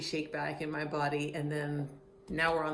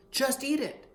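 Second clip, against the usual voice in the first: higher retorting voice; hammed-up retort, raised; mock retort; 8 semitones higher, 3 dB louder than usual. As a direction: snap it back higher. Imagined reply quoted.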